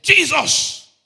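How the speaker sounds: screamed high and loud in terror; man in the burning plane shrieks Jesus; desperate shout. Retold or imitated. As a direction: cry out the name as the plane burns.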